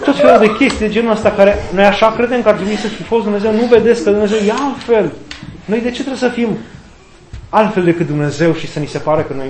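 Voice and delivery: usual speaking voice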